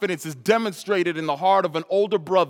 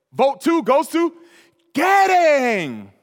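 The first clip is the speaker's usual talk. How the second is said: slow, drawn-out announcement, higher and louder; father announcing; 12 semitones higher, 9 dB louder, much slower than usual. Retold or imitated. Father announcing the vote to the kids, slow and loud. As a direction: announce the vote winner like a game show. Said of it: "my father says".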